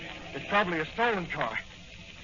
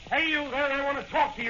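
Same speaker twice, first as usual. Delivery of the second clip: calling out; 9 semitones higher than usual. Acted. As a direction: call out high across the street. Cop calling out to suspect.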